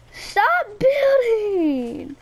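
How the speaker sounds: high, very drawn-out wail sliding down; exasperated whine. Real, not acted. Gamer begging a friend to stop building, exasperated whine. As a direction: start high and stretch it into a whining plea. Repeats the protest, escalating.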